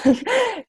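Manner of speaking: sudden high giggle; burst of laughter. Real, not acted. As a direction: break into a laugh. Mom mocking her mid-answer.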